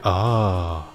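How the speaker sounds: ordinary delivery, nothing marked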